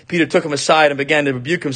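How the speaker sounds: ordinary delivery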